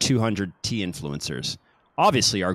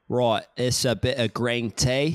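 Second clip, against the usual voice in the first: slow, drawn-out caricature British; mock British tea teacher; British accent. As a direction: lay the British accent on thick. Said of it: "there's one in the U K".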